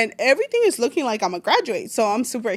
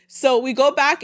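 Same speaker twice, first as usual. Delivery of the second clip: ordinary talk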